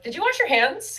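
high, pointed question; suspicious question. Retold or imitated. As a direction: ask it with suspicion, pitch up. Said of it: "And I'm like".

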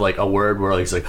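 ordinary talk, nothing marked

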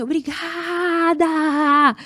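loud, high, hugely drawn-out mock shout; grito de agradecimento imitado. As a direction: stretch the word out in a re-enacted triumphant cry. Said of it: "eu tô gritando assim". (grito encenado de gratidão, bem alongado)

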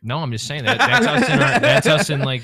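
loud, high, laughing protest; laughing defense. Real, not acted. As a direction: defend yourself through laughter. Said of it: "the fucking Joker".

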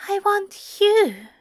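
high, slow, insistent lover's voice; high-pitched amorous character voice; amorous angel. A narrator's reading. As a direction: draw it out high, full of desire. Lover repeats his declaration while kissing.

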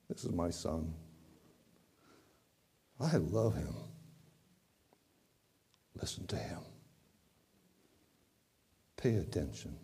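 soft, low, hushed voice of God; barely there, tender and still; a still small voice, nearly whispered; gentle small voice. Acted. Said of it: "the voice is gentle, almost small voice".

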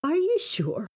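put-on high, incredulous boy's voice; squeaked. A narrator's reading. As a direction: squeak the question in disbelief. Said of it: "squeaked Thomas".

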